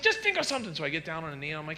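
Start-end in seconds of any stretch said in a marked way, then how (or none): 0.0-0.7 high-pitched urgent prodding; impatient wife. Acted. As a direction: urge it in a high, exasperated voice.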